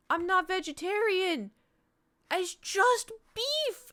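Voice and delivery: high, rising exasperation, slowing at the end; stretched, high-pitched retold protest; exasperated protest. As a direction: plead your case to relatives who never listen. Acted exasperated protest to family.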